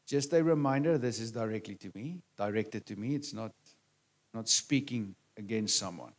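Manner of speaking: soft, low disclaimer; quiet aside. Real, not acted. Preacher reassuring listeners it's directed to himself, quiet aside. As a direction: drop the voice for a gentle reminder.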